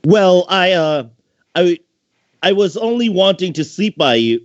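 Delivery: put-on high girlish voice, stammering; high-pitched little catgirl, caught out; little sister voice. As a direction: play the flustered kid sister. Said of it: "with a cocky expression".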